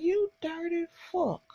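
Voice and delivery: high, soft and breathy curse at him; disgusted hiss. Retold or imitated. Viewer cursing the coach on screen, a soft disgusted hiss. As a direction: spit it out quietly in disgust. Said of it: "I like".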